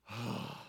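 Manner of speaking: long low weary sigh; deep weary sigh. Acted. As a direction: let out a tired sigh, as if here we go again. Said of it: "And he sighed deeply in his spirit".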